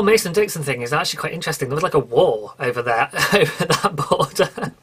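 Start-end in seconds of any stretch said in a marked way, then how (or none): 1.7-4.7 talking through a laugh; amused, laughing as it trails out. Real, not acted. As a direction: let laughter break into the words. Laughing about a war over border.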